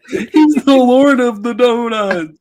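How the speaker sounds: high, slow laughing voice; laughing while speaking. Real, not acted. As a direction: giggle through the words.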